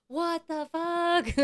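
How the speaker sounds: high, drawn-out, scandalized exclamation; hammed-up appalled reaction on camera; mock disbelief. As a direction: stretch every word in exaggerated shock. Filming the shaver, acted outrage.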